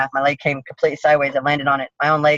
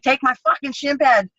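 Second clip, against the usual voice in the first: high, sharp yell of pain; retold scream; 9 semitones higher than usual. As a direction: yell the demand in frustration. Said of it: "and I'm screaming".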